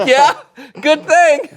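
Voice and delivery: laughing through the words. Real, not acted. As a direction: chuckle while saying it. Laughing at irony.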